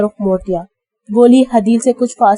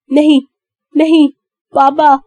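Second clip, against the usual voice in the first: 1 frantic no; 5 semitones higher than usual. A narrator's reading. Frantic denial again.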